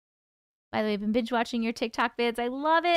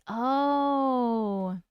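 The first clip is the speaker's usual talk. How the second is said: ordinary talk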